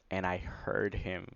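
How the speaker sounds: ordinary delivery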